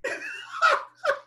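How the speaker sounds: sudden high peal of laughter; burst of laughter. Real, not acted. Host cracks up at the guest's name story, laughing hard.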